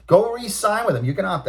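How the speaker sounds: high, loud, exasperated telling-off; scolding rant. Real, not acted. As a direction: lecture him as if he stood there. Scolding the crying player.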